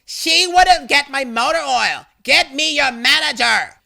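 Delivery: high, loud indignant complaint; angry shouting. A narrator's reading. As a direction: shout the complaint like an outraged customer. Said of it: "A W starts shouting again".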